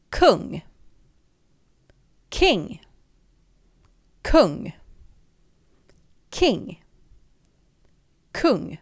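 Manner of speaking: Kung with a rounded u, King with a short i, in turns; alternating kung and King. Shown as a demonstration. Teacher drilling the Swedish and English pair. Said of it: "in Swedish we pronounce it".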